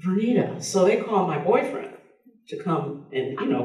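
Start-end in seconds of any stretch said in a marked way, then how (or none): 0.0-0.6 shocked. Retold or imitated. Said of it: "They were like".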